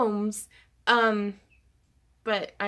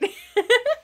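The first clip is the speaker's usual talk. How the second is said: high, loud burst of laughter; delighted laugh; 13 semitones higher, 6 dB louder than usual. Real, not acted. Streamer laughs at a viewer's surprise gift, high giddy laugh.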